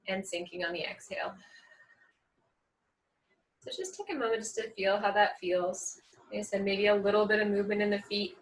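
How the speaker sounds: soft, calm yoga-teacher voice; guided grounding meditation. Real, not acted. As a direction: ease them gently into their breath, with long pauses.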